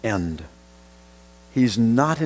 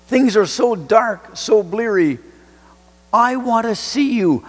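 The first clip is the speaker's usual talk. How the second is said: higher, urgent pleading to God; exasperated plea; 6 semitones higher than usual. Acted. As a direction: plead as if God has gone missing.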